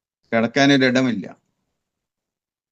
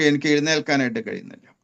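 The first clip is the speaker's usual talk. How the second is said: usual speaking voice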